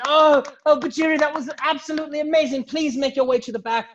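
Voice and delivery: high, gushing announcer voice; stage hostess Prima. Acted. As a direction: gush in a high voice, then send up the next act. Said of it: "Prima walks back on stage".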